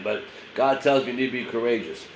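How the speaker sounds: normal talking voice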